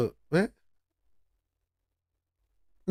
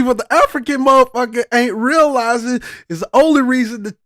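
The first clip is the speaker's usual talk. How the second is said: ordinary delivery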